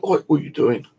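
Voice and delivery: high sharp "Oi" called out across the woods; retold call-out. Stranger challenging him while he cuts wood, high mock call-out.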